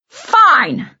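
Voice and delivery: high, loud furious outburst; screamed. A narrator's reading. Director giving up on his lead, screaming. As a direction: explode on the word, high and loud. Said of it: "he screamed".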